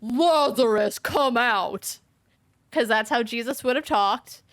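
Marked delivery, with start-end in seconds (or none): 0.0-2.0 a firm, ringing command to the dead man; a preacher's calling voice, moderately raised; loud call. A narrator's reading. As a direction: call him out of the tomb, full voice. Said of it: "Jesus called in a loud voice".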